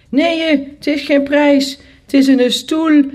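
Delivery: loud, high-pitched correction; youngest brother calling out. A narrator's reading. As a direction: call it out as the excited little brother. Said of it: "riep mijn jongste broer". (luid en hoog roepend)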